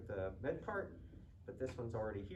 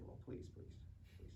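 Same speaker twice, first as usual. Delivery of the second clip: mock-humble whisper; whispered please; 12 dB quieter than usual. Student jokingly hushes his weeping instructor, whispered mock modesty. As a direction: wave off the praise in a whisper.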